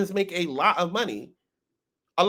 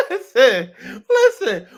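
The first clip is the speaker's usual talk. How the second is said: high-pitched words through laughter; wheezing with laughter while talking; laughing; 6 semitones higher, 8 dB louder, slower than usual. Real, not acted. Laughing hard at drama.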